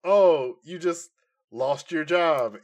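ordinary talk, nothing marked

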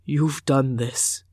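slow, hoarse and strained; stunned father rasping at his daughter, hoarse. A narrator's reading. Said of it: "he said hoarsely".

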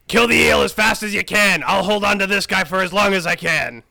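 loud battle cry to allies; shouted command. Acted. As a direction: shout it over the fight. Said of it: "and I'm saying".

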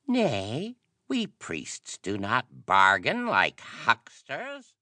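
high, drawn-out priestly refusal; slow, high-pitched disdain; scornful priest. A narrator's reading.

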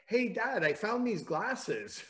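put-on high, slow quoted child's call; imitated daughter. Voices young daughter calling to dad.

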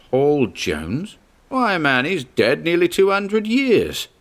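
high, disbelieving exclamation; incredulous. Acted. Charteris disbelieves the shellback.

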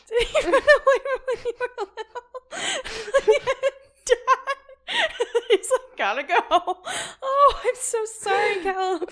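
high, loud, breaking into laughter; words squeezed out through helpless laughter; laughing hard. Real, not acted. Friend reacts to the runaway-dad review, laughing hard.

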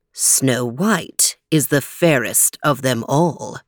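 low, slow magic mirror; shimmering echoing mirror voice. Acted. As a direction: speak slowly and deeply as the mirror. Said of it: "said the magic mirror".

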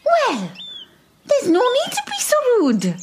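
prissy high-pitched indignation; high, huffy and affronted; offended high voice. Acted.

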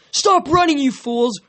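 high, commanding shout, dropping at the end; exasperated villain's yell; angry yell. A narrator's reading. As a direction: order fleeing troops to stop. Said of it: "Kronos yelled".

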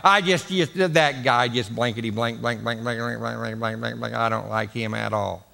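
mumbled low grumbler, fading to muttered blanks; mock grumble. Imitating a gossip grumbling about Mark Shell, fading low. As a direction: grumble half under breath. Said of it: "somebody says".

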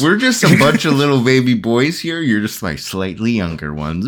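usual speaking voice

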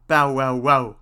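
loud imitated bark; storybook dog's loud bow-wow. A narrator's reading. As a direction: bark out three words like a dog. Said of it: "says the dog".

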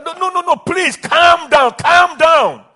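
high, loud, slow calm down; preacher crying out; loud pleading. Acted. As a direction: urge them at full force. Sermon climax, pleading.

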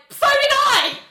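high loud exclamation; wife shouting she wanted a fight too; mock shout. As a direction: blurt it out. Georgia voiced in quarrel.

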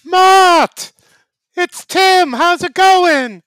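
loud, high, slow hammed-up character greeting; loud put-on Tim voice. Tim bursting in to greet Matt, loud high goofy voice.

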